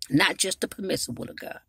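normal talking voice

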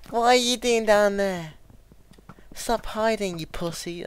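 high taunting. Real, not acted. Taunting another player.

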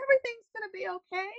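singsong carefree. Retold or imitated. Quoting her own carefree motto.

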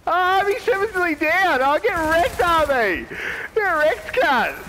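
high, excited gloating jeer. Real, not acted. Gamer sees the rival nearly down, gloating. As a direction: crow over a downed rival.

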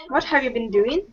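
usual speaking voice

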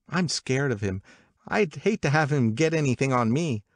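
high, lively gossiping woman; gossipy Catherine. Acted. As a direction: pitch it up like eager party gossip. Voices Catherine's party gossip.

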